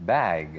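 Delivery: "bag" drawn out to a voiced G; long voiced ending. Shown as a demonstration. Teacher gives examples of the rule, long voiced ending. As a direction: stretch "bag" and sound the final G. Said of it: "ต้องลากเสียงยาว".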